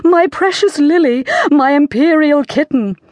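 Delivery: high-pitched queenly cry. A narrator's reading. Queen rushing to her child, high cry. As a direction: call to your darling in a high voice. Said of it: "the Queen cried out".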